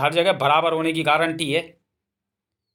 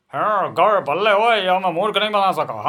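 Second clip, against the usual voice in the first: gloating hyena; 3 semitones higher, 3 dB louder than usual. Acted. Hyena tells the pack the man can't fool them, gloating growl. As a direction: crow like a pack beast that won't be fooled.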